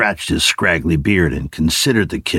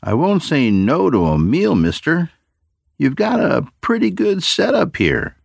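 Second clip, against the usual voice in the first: put-on much higher, youthful boy's voice, friendly and ingratiating; eager teenage boy; 12 semitones higher than usual. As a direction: play the kid sweet-talking the old man. Boy accepts the meal.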